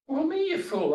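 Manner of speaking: mock exasperated debater; scornful retort. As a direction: snap back as if it's obvious. Debater scoffs at the Buddha.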